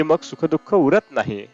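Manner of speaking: ordinary talk, nothing marked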